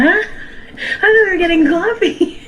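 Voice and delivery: high, slow, incredulous with a laugh; laughing disbelief, voice shooting up; baffled. Retold or imitated. Retold baffled reply to him.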